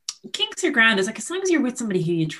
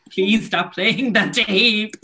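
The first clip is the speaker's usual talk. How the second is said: slow, drawn-out mock plea; mock pleading; 3 semitones higher, 4 dB louder, much slower than usual. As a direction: beg them to stop, half-joking. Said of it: "was like".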